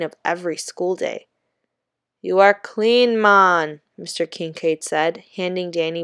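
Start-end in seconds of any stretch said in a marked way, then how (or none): 2.2-3.8 slow Jamaican drawl on man; Jamaican accent. Acted. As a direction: stretch out man Jamaican style. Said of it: "as if there were several extra letters in the word".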